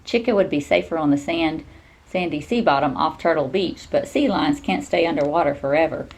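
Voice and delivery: plain reading voice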